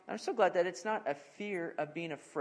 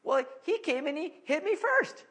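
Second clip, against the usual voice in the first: much higher whining protest; defensive kid; 12 semitones higher, 5 dB louder than usual. Retold or imitated. Kid defending himself to dad, high whining protest. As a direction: plead it like a kid caught out. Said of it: "And they'll say".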